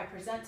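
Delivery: "present" with the weight on the end, pre-ZENT; verb "present". Shown as a demonstration. Tutor giving an example sentence, "present" stressed on ZENT. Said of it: "And then it's a verb".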